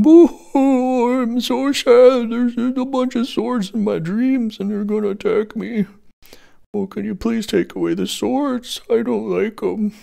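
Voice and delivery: high, whiny mock sob voice; mock crying person. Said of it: "this is a crying person in bed".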